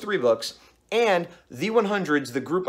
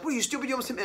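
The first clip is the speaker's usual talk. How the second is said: mock rant; 8 semitones higher, 5 dB quieter, much faster than usual. Repeats the driver's endless rant.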